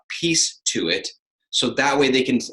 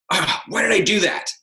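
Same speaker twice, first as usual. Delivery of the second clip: higher, groaning "why did I"; exasperated self-reproach; 8 semitones higher than usual. Shown as a demonstration. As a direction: beat yourself up with a rhetorical question. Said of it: "even the way I say that".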